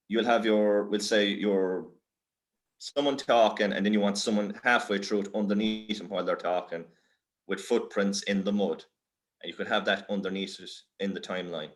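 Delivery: ordinary talk, nothing marked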